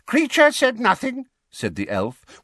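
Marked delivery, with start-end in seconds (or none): 0.0-1.3 high, loud elf voice; servile elf denial. A narrator's reading. House elf answering George, loud servile denial. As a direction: protest innocence in a high elf voice. Elf loudly denies his muttering.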